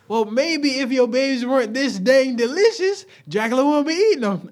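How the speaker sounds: high, loud cartoon retort; Dracula. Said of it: "my boy Dracula was like".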